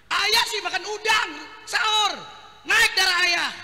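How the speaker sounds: high, loud nagging scold; scolding wife. Acted. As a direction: scold him for the shrimp.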